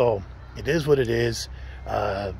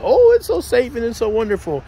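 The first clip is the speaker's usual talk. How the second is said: high, louder sing-song mockery of the claim; mock the naive tourist line, pitched up and louder; sarcastic mockery; 10 semitones higher, 6 dB louder than usual. Scoffing at people who call Mexico safe, high sarcastic sing-song. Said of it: "people can say whatever they want".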